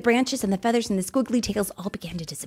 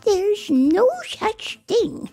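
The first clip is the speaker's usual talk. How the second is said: put-on sweet old woman; 13 semitones higher, 5 dB louder than usual. Old woman examining Camilla, high granny voice. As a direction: cluck it like a fussing grandma.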